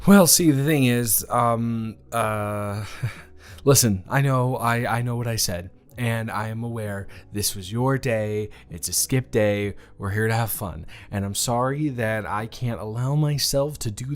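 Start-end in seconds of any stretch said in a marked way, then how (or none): none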